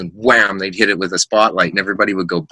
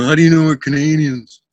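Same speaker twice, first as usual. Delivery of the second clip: higher put-on voice for indignant strangers; mock challenge in a raised voice; imitated hockey players; 6 semitones higher than usual. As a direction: answer back as the startled players. Players' surprised reply, imitated.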